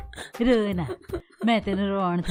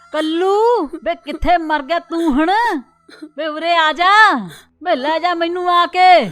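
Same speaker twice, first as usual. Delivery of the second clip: loud, higher call across the yard; 5 semitones higher, 7 dB louder than usual. Acted. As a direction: shout for Kallu to come fetch her. Old woman calls for her son. (ਉੱਚੀ ਆਵਾਜ਼ ਵਿੱਚ ਪੁੱਤ ਨੂੰ ਹਾਕਾਂ ਮਾਰਨਾ)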